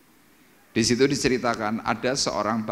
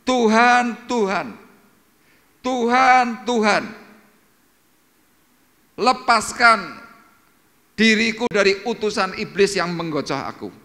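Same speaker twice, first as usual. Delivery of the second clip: high, loud cry of "Tuhan, Tuhan"; anguished calling out to God; desperate plea; 11 semitones higher, 7 dB louder than usual. Retold or imitated. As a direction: beg God like Paul in pain. Paul pleading three times.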